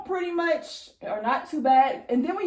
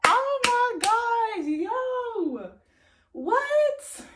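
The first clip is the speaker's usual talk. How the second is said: high, drawn-out amazed exclamations; excited; 9 semitones higher, much slower than usual. Real, not acted. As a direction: stretch each word high in excitement. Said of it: "I am so excited".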